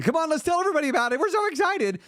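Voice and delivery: very high, fast, giddy tempter voice; giddy inner voice. Pretend. Tempting inner voice urging him to tell everybody, giddy high. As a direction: squeal like an overexcited inner child.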